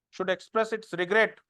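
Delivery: slow, spaced dictation with long pauses. Acted. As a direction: dictate each phrase slowly so students can write it down. Dictating notes to students. (धीमी आवाज़ में notes लिखवाना)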